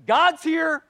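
hammed-up gasp of awe; mock awed worshipper. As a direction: burst out like a wide-eyed believer. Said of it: "it's just like".

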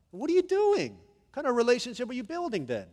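high, loud exasperated questioning; incredulous challenge. Real, not acted. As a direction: call out their excuse in disbelief. Preacher challenges excuse-maker.

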